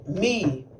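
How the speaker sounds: usual speaking voice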